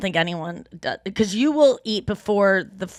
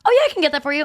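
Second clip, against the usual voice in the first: mock waiter; 6 semitones higher, 4 dB louder, much faster than usual. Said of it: "and they go".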